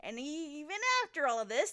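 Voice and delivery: ordinary delivery, nothing marked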